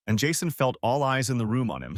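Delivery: ordinary delivery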